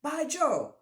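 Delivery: high, loud startled gasp; French-Canadian lumberjack gasping By Joe; gasped exclamation. Acted. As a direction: blurt it out in shock. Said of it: "he gasped".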